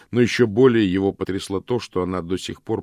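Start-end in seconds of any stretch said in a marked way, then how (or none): none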